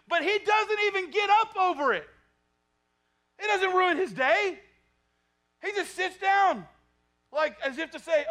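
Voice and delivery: loud, high, fired-up sermon delivery; pulpit-pounding preacher at full volume; full-force preaching. Acted. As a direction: preach it out to the whole church.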